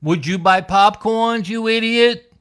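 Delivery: put-on high, loud bratty kid's insult; kid with adult voice. A twelve-year-old mocks the man's lyrics, high and loud. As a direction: taunt him like a smug twelve-year-old. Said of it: "one of those adult voices".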